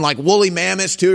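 ordinary talk, nothing marked

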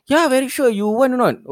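insistent sales voice; scam caller voice. Retold or imitated.

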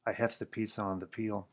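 ordinary delivery, nothing marked

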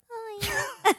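high-pitched, squeaky fake moan; hammed-up squeal of bedroom pleasure; mock climax. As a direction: put on a silly high moan. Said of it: "He's about to nut".

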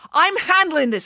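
high-pitched, cutting interruption; irritated snap. A narrator's reading. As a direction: snap to shut down the objection. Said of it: "snapped the vice principal".

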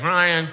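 "Ryan" with no B at the front; first letter dropped. Retold or imitated. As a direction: say "Ryan", starting straight on the R. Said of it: "you skipped the first letter altogether".

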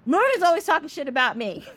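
high, quick mock complaint; indignant tattling. Sun complains about Moon to Olorun, indignant. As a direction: tattle like an aggrieved sibling.

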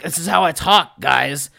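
a scared dwarf who wants to go home; dwarven bard voice. Retold or imitated. Dwarven bard begging the party to leave, loud put-on voice. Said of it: "some really dumb, lame voice".

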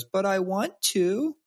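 put-on high, slow whining child voice; imitating a pouting daughter; whiny toddler. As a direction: whine it like a pouting three-year-old. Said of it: "She goes".

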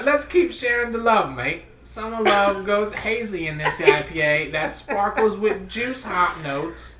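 reading the label in a hammed-up British accent; lightly British, ad-read feel; mock British accent. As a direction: sell it like a jolly Brit. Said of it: "hillbilly Australian British".

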